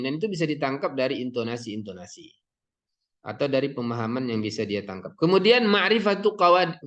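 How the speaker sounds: ordinary talk, nothing marked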